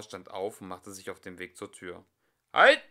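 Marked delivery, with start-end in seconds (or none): 2.5-2.8 sudden loud high shout; shouted halt. Acted. Singer stops the leaving couple, loud shout. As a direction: bellow to stop them. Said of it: "rief Walter von der Vogelweide".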